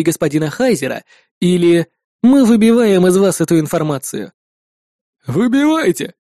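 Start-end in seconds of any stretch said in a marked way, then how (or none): 5.3-6.1 higher-pitched smirking retort; scoffing. A narrator's reading. Second twin defies his interrogator, scoffing. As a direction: toss it back with mild scorn. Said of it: "усмехнулся второй брат".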